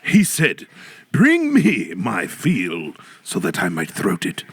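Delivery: mock olde storyteller.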